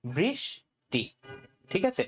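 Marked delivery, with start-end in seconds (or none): 0.0-1.0 বৃষ্টি drawn out slowly in two parts; syllable by syllable. Shown as a demonstration. Teacher splitting a student's name into syllables, slow. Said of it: "এখানেও কিন্তু দুই ভাগে ভাগ".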